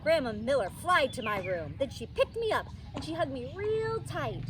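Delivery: ordinary delivery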